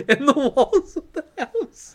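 high, loud laugh through the words; words stretched out and breaking into real nervous laughter. Real, not acted. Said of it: "I'm laughing, but it's also, like, a nervous thing".

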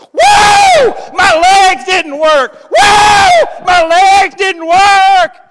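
shouting for joy, high and loud; healed cripple whooping and shouting; ecstatic healed man. Retold or imitated. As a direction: whoop like you just walked again. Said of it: "Somebody's up there going".

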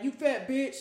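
hammed-up harsh mother; mock harsh mom. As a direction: spit it like a mean mom. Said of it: "she don't be like".

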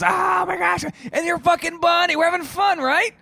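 shrieking high and loud, gushing; gushing girl. Retold or imitated. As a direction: squeal it like a thrilled girl on a date. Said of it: "And then she goes".

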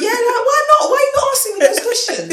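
high, loud, half-laughing protest; loud, indignant demand. Real, not acted. Telling friend men should ask, loud indignant protest. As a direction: demand it as if it's obvious.

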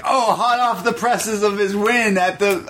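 high, mock-excited announcer voice. Mocking the show's writers with a high hype voice. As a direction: ham up the hype to make fun of it. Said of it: "They really were like".

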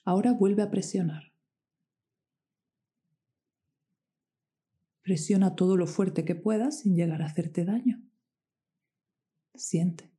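whispered press and release cues; guided meditation. Acted.

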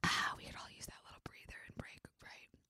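very soft whispered sigh; hushed aside. Real, not acted. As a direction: whisper it as a little breather. Pause for a sip and breather.

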